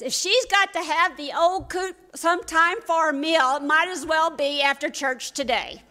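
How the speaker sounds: a child imitating mom's shout, high and loud; mock shouting mom. Boy retells mom yelling at pa, mock shout. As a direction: yell it like mom did, told by a child. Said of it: "my mom shouted at my pa".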